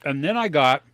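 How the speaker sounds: talking with a mouthful; words round a bite of candy; mouth full. Real, not acted. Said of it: "sorry for talking with my mouth full".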